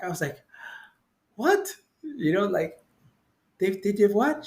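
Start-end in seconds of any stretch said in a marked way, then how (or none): 1.4-4.4 high, incredulous questioning; retold shock. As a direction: re-enact stunned disbelief. Acts own shocked reply to nurse.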